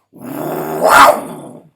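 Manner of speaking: deep, loud breathy roar; jaguar voice. Shown as a demonstration. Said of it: "The jaguar sound goes".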